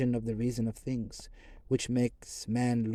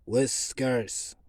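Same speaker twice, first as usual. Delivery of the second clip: stern. A narrator's reading. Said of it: "he answered sternly".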